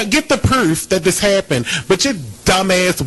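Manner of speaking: ordinary talk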